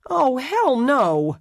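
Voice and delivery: high, loud, slow drawn-out refusal; strident refusal. Acted. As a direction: stretch it out in flat-out refusal. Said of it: "her voice a bit strident".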